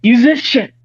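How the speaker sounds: ordinary delivery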